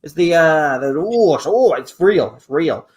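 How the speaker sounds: normal talking voice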